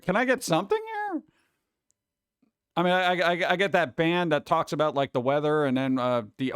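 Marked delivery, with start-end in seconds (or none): 0.0-1.2 pleading to the radio. Retold or imitated. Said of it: "it's like".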